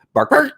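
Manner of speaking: high imitated double bark; two quick dog barks, up high; dog barks imitation. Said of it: "your sound effects are spot on".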